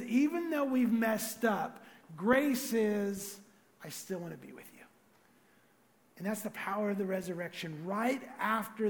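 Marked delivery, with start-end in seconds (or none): 3.8-4.9 softly, near hush; hushed reassurance from God; soft tender line. Pretend. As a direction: say it gently, tender and low. God's words, very soft.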